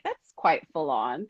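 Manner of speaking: understated, drawn-out polite dismay; mortified British understatement. Retold or imitated. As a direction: say it slowly, with mock British reserve. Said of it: "more particularly the Brits".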